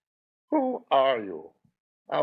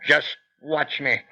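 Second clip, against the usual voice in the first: normal talking voice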